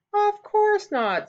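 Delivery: high, drawn-out "oh, of course not" tone; innocent denial. A narrator's reading. Turtle assures the children she won't escape, innocent denial.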